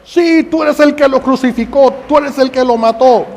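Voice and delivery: very high, very loud accusing shout; screaming accusation. Acted. Mary Magdalene screaming at the soldier, accusing. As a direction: hurl it at his face.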